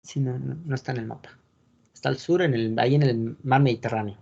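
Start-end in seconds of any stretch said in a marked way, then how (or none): none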